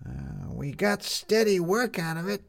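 put-on high, slow young girl's voice; a street kid answering, drawn out and high; Lizzie. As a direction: newsie girl answering Maddie, high and slow. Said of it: "said Lizzie".

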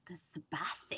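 whispered seductive tease; sultry whisper. Pretend. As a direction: purr it like a perfume ad.

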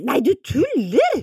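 loud, high, slow delight; delighted disbelief. Real, not acted. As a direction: gush in disbelief. Får gaven. (høy, langsom begeistring)

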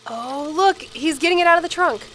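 calling attention, thrilled; excited pointing out. Real, not acted. Spotting grandfather in home movie.

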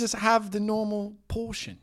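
put-on high, slow, exasperated British accent; drawn-out mock British pleading; Jason Statham. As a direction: protest in a hammed-up British accent. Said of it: "had to do the accent".

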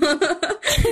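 bright burst of laughter; high laugh. Real, not acted. Laughing after own quote.

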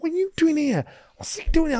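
mock bewildered inner voice; retold disbelief. Boxer spotting familiar faces in the gym, incredulous.

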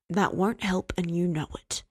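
usual speaking voice

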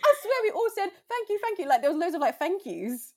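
high, loud excited retelling. Real, not acted. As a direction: bubble over with excitement.

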